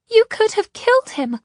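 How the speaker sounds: high, loud horrified protest; upset, high-voiced accusation; distressed. A narrator's reading. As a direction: cry out, appalled, pitched far up.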